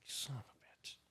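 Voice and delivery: under-breath whisper; muttered curse. Retold or imitated. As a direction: hiss it to yourself. Friend hangs up on him.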